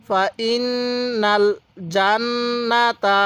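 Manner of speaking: slow chant, long nasal nn in ইন্নাল and জান্নাতা; sustained, level ghunnah on ইন্নাল; joined recitation. Shown as a demonstration. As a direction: hum each doubled n in জান্নাতা. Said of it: "এবার এখান থেকে মিলাই পড়ব".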